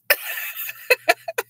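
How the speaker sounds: long, high giggling fit; real laugh. Real, not acted. Host amused by childhood boarding school wish, giggling. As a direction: crack up at your own question.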